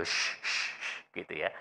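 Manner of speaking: voiceless shh as if shooing chickens; soft voiceless shushing; shooing hiss. Shown as a demonstration. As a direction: hiss it out to chase off a hen. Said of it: "mengusir ayam itu".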